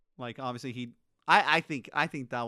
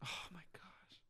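breathless whisper of disbelief; barely voiced oh my gosh; 4 semitones higher, 16 dB quieter than usual. Real, not acted. Cohost reacting to sleeping idiots on Steve Martin, whispered.

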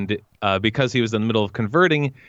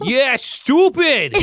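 high, drawn-out Brooklyn jeer; mock Brooklyn insult, pitched up; Brooklyn accent; 4 dB louder, much slower than usual. Shown as a demonstration. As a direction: stretch it out in cartoon Brooklyn. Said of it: "I would like to hear your Brooklyn".

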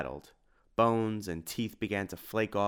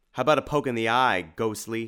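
a touch louder, higher battle quip; bold taunt; 3 semitones higher, 7 dB louder than usual. A narrator's reading. Tim taunts the ghostly eyeball as he swings, bold taunt. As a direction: toss out a cocky challenge.

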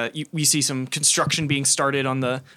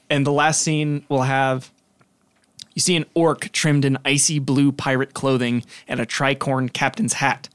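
normal talking voice

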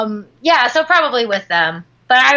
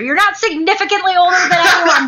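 indignant; 6 semitones higher than usual. Real, not acted. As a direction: call him out, half-yelling. Mock outrage at age claim.

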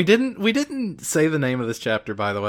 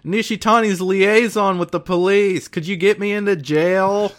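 loud, high Majima hail; very loudly; 7 semitones higher, 6 dB louder than usual. Retold or imitated. Majima loudly greets the detective Billiken. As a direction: call it out loudly and boldly. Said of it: "Majima says very loudly".

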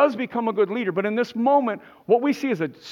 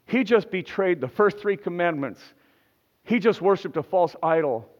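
usual speaking voice

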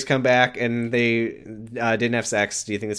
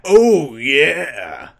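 stretched, falling character catchphrase; Cat in the Hat; 3 dB louder than usual. Acted. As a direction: put on a movie-cat voice, punching the first word. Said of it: "Cat in the Hat".

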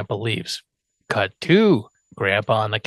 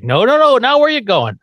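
high, loud "no, no, no"; calling him back; 12 semitones higher, 6 dB louder than usual. Retold or imitated. Grandpa stopping Herman at the door, loud and high. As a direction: stop him from walking out.

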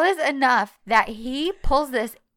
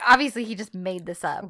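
usual speaking voice

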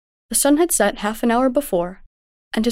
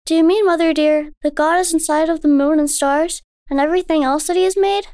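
put-on high, wondering child; a little girl full of awe; young girl voice; 7 semitones higher than usual. A narrator's reading. Daughter tells her mother about the moonlit lake, in wonder.

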